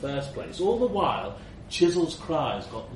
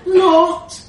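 much higher, much louder shriek; 14 dB louder than usual. Acted. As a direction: shriek it from inside the suitcase. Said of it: "shrieked Chisel through the leather".

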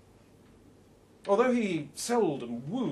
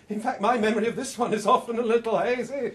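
laughing through the joke, higher and louder; 6 semitones higher, 7 dB louder, faster than usual. Acted. The narrator joking with Harry, laughing as he speaks. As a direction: chuckle while owning up.

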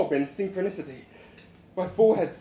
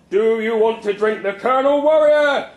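loud, high rally cry; rousing shout; 4 semitones higher, 8 dB louder than usual. Acted. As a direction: demand an answer from the crowd. Boss rouses crowd again.

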